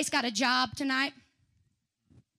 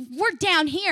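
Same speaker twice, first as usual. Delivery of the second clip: ordinary talk, nothing marked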